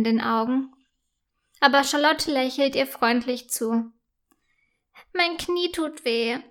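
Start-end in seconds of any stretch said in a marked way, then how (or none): 5.1-6.4 put-on high, soft, tearful child; sobbing. A narrator's reading. Hurt girl sobs about her knee to a kind classmate. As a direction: whimper through light tears. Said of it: "schluchzt Yvonne".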